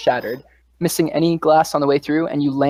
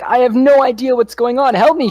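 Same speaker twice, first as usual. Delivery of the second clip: much higher, louder pleading man; panicked Jeremy; 10 semitones higher, 6 dB louder than usual. Acted. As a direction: plead for help, totally lost. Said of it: "He's like".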